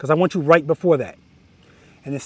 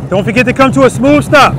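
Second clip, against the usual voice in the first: called out to rider; 6 semitones higher, 8 dB louder than usual. Real, not acted. Calling out to rider mid-exercise.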